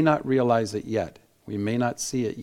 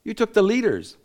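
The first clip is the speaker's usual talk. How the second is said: much higher, building up their deeds; eager praise; 11 semitones higher than usual. Retold or imitated. Gideon crediting the Ephraimites, high and praising. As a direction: keep flattering them, voice lifted. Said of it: "He's saying".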